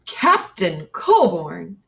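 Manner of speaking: a colonel calling an officer to order; stern colonel. Acted. Colonel to Captain Colborn, slow stern address.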